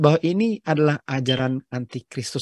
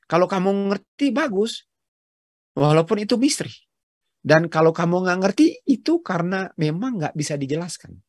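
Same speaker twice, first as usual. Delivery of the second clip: ordinary talk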